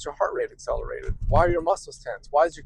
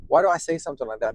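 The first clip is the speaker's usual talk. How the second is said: ordinary talk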